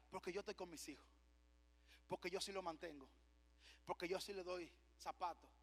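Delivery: very soft, low quoted defense; quiet self-justification. Retold or imitated. Father justifying himself to others, hushed and low.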